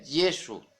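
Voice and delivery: "Jesús" said "YEH-soo", stressed first; Latin pronunciation. Shown as a demonstration. Preacher teaching the Latin name, "Jesús" as "YEH-soo". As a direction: say "Jesús" with initial Y, dropping final s. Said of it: "que se pronuncia".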